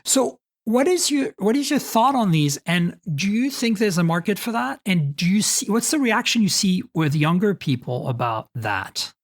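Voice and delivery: ordinary talk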